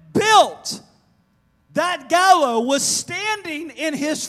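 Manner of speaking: high, loud, slow emphatic preaching; full-force preaching. Real, not acted. Preacher stressing where the gallows stood, full-force preaching. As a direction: hammer each phrase home at the top of the voice.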